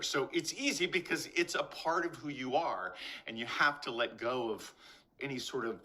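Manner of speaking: ordinary delivery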